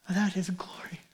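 hushed, breathy whisper of wonder; awed whisper. Real, not acted. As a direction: drop to a reverent whisper. Awed whisper on God's glory.

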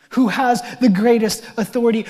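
ordinary talk

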